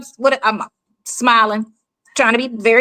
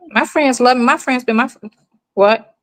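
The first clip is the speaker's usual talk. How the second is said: ordinary talk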